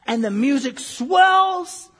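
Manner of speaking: slow, swelling loud on the last word; dramatic swell. Acted. Preacher mocking a movie climax, grand swelling delivery. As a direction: stretch the final word like a crescendo.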